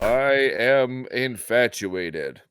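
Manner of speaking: very slow, flat, emotionless; slow monotone. Pretend. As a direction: drag it out deadpan. Ghost declaring love, monotone.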